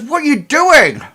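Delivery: a startled woman bursting in; shocked Ida. Acted. Ida rushing back in at the scream, a high shocked cry. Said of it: "she says".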